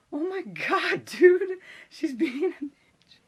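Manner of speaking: very high, squeaky disbelief; hammed-up squeal of outrage; acted shock. Student to friend about substitute, mock shocked squeal.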